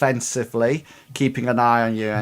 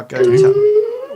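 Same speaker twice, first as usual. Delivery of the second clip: sudden high squeak; 8 dB louder than usual. Real, not acted. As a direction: voice jumps very high and loud.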